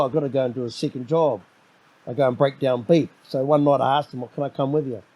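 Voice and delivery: normal talking voice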